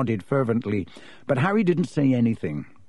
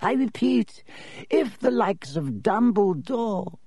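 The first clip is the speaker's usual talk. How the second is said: reedy professor; 9 semitones higher, slower than usual. Acted. Professor cut off.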